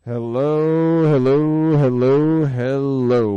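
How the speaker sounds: loud, drawn-out chain of hellos; big opening greeting. Real, not acted. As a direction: sing out a booming radio welcome, stretching each hello. Show host's drawn-out opening greeting.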